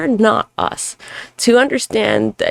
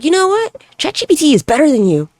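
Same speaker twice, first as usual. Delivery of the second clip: higher, louder, snapping back at the chatbot; heated retort; 9 semitones higher, 4 dB louder than usual. Retold or imitated. Arguing with the Gemini chatbot, heated retort. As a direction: throw it at the AI like a challenge. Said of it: "I got in a heated fight".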